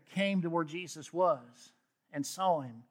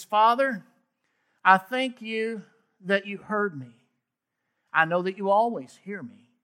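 fervent prayer; 3 semitones higher, 6 dB louder than usual. Acted. Jesus praying aloud.

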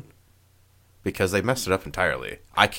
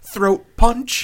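excited; 9 dB louder than usual. Pretend. Joking about throat punching.

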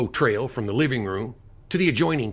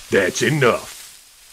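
firm command; 7 dB louder, much slower than usual. Acted. Lash breaking up fight.

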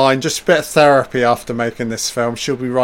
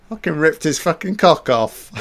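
ordinary talk, nothing marked

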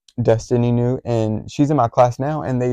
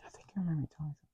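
quick unvoiced whisper; fast whispered aside to a friend; 5 semitones higher, 18 dB quieter, much faster than usual. Real, not acted. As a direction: slip it in under your breath, fast.

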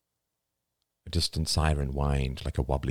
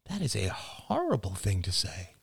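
shocked; 4 semitones higher, 5 dB quieter than usual. A narrator's reading. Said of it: "He stared in shock".

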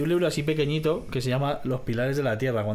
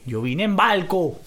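put-on slow Caracas street-thug accent; drawn-out barrio slang voice; Petare malandro; 3 semitones higher, slower than usual. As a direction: answer like a cocky hoodlum. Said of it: "Como malandro de Petare".

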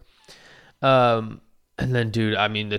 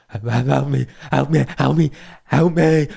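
a hint of an old man's plea, climbing higher and louder; mock plea for help; 4 semitones higher, 6 dB louder than usual. Imitating the old man begging for help, rising and louder. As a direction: plead over and over, each one higher.

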